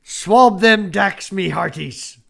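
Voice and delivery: slow loud Irish pirate call; Irish pirate accent. Pretend. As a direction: bellow like an Irish pirate, slowly. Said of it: "You could do a good pirate".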